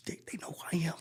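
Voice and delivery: whisper. Retold or imitated. Stunned aside at celebrity party.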